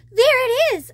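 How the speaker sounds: high, loud joyful cry; excited cry. Acted. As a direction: call it out in delight. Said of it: "I cried".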